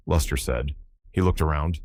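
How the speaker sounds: plain reading voice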